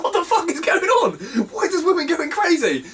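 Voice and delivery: very high, re-enacted disbelief; astonished yelp, retold with relish; incredulous outcry. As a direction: exclaim it in amused shock. Retold disbelief at woman punching people.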